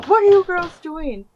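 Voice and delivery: high-pitched mock scolding from mom; a mom catching the girls screaming, mock angry; mimicked mad mom. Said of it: "She was just mad".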